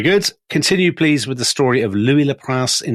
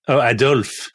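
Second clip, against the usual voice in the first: a touch of West Yorkshire on Adolf; mock West Yorkshire twang, teasing; West Yorkshire accent; slower than usual. West Yorkshire accent, teasing his co-host's pronunciation of Adolf. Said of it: "pronouncing it in a West Yorkshire way".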